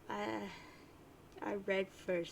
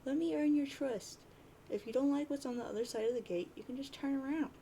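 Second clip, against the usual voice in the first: higher, louder, urgent plea to follow the map; pleading troll girl; 5 semitones higher, 3 dB louder than usual. Retold or imitated. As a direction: beg him to trust her, pitched up. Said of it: "And she says".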